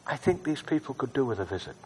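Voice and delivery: hushed, gentle prayer; soft prayer. Shown as a demonstration. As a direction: say it softly as a prayer. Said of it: "And just say".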